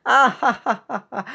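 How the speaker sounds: high, exaggerated laugh; hammed-up laugh at your own pun; big mock guffaw; put-on laugh.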